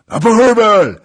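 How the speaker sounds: high, roaring war whoop; wild battle cry. A narrator's reading. Attackers bursting into the hall, wild high yell. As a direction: yell it like a savage horde. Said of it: "zabrzmiały dzikie głosy".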